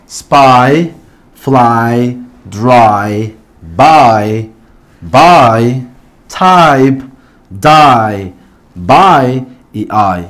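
spy, fly, dry, buy, type, die in low, separate drill beats; long I examples. Shown as a demonstration. Teacher reading a set of examples to learners, long I sound. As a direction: end each of spy, fly, dry on a long falling 'ai'. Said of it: "tenemos un set de ejemplos".

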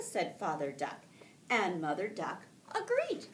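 lively storybook read-aloud with voiced ducks; reading to children. Teacher reading a picture book aloud to her class, lively and gentle.

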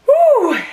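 high sharp exhale whew; burning mouth whew. Real, not acted. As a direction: blow out the burn, loud and high. Exhale after hot chili bite.

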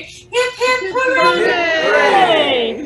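high, loud cheer; full-throated hip hip hooray; cheering shout. Real, not acted. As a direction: lead the crowd in a big hooray. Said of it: "And again".

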